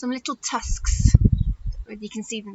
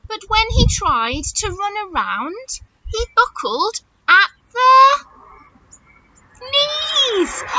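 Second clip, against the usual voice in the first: rising higher and louder to a shouted knees; storybook build to a shout; 8 semitones higher, 5 dB louder, slower than usual. A narrator's reading. Teacher reading rhyme to nursery children, building to a shout. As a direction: build the suspense to the punchline.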